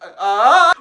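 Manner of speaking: loud, high stammer of mock panic; hammed-up startled yelp; mock freaked-out reaction. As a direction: blurt it out as if badly startled. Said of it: "and I'd be like".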